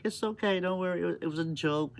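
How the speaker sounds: high, soothing it's okay; calm her down sweetly; reassuring. Retold or imitated. Mom soothing her angry daughter about the joke, high and reassuring. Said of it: "And I was like".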